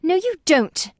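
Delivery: a high, loud snap; Lucy contradicts Cecil, high loud snap; snapped retort. A narrator's reading. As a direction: cut him off sharply. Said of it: "she snapped".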